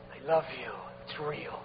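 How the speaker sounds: low, very soft confession of love; intimate prayer. Acted. Worshipper telling God of his love, intimate and hushed. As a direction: murmur it privately to God.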